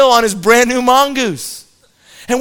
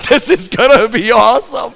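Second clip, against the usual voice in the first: put-on a touch higher, eager boyish glee; gleeful anticipation; 3 semitones higher, 3 dB louder than usual. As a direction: relish the crash about to happen. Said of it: "we're all like".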